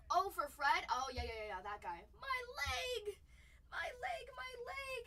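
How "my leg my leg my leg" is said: high pained wail, my leg; hammed-up cartoon cry of pain; mock cry. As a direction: squeal it like a hurt cartoon character. Acting out SpongeBob cry.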